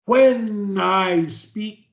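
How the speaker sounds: "When I speak" very slow, ending on a crisp k; exaggerated pronunciation. Shown as a demonstration. Coach modelling for a stutterer, slow and over-articulated. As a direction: draw out each word of "When I speak". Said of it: "do the pronunciation the same way I do it".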